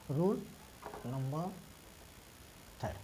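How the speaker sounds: word by word with long pauses. Shown as a demonstration. Dictating the rule for writing. (धीरे-धीरे, रुक-रुक कर लिखवाते हुए)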